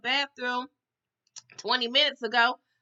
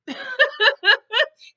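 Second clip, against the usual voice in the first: high, loud burst of laughter; 14 semitones higher, 9 dB louder than usual. Real, not acted. Laughing at his "only hope" claim, loud high laugh. Laughs at mocked grand title.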